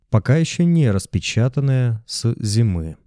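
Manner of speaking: ordinary delivery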